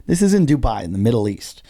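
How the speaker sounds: usual speaking voice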